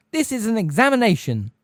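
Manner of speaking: loud, high indignant protest; be appalled that the exam is disturbed; scandalized. A narrator's reading. Examiner protesting to the whole class, loud and scandalized. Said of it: "in a scandalized voice".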